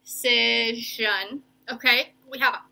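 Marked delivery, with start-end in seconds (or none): 0.0-1.4 "Decision" drawn out slowly; syllable by syllable. Shown as a demonstration. Teacher sounding out a word for pupils, "Decision" slowly syllable by syllable. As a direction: stretch out each syllable of "Decision". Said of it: "we can't break it up".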